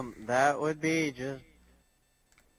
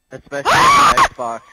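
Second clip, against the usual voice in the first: sudden shout; 14 dB louder than usual. Real, not acted. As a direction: shout it high and loud. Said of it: "You just scared the shit out of me".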